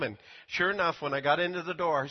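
ordinary talk

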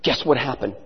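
low, soft teasing question; hushed teasing. Retold or imitated. Bill calling the pastor with news, low and teasing. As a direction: tease it low and quiet. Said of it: "he goes".

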